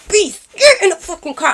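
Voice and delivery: angry command to Beast; angry yell. Retold or imitated. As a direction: yell at him to get in the car. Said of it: "I'm getting mad".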